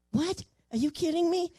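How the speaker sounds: jolted by the news; astonished. Retold or imitated. To the captain about the bear, shocked disbelief.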